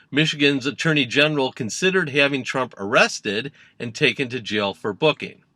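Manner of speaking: ordinary talk, nothing marked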